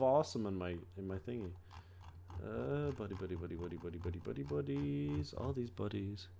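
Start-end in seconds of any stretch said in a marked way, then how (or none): 2.4-6.3 low, soft mumble of names; muttering to himself. Real, not acted. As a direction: mumble it under your breath. Muttering buddies list.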